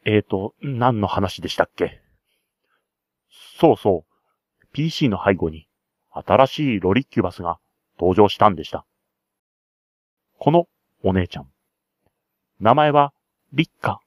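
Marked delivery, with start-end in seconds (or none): none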